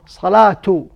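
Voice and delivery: "Salatu" ending in a sounded -tu; ta marbuta as t. Shown as a demonstration. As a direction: say "Salatu" with t plus u, not a final h. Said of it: "As a ta".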